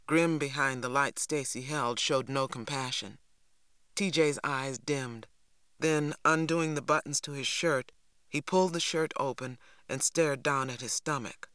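usual speaking voice

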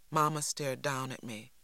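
ordinary delivery, nothing marked

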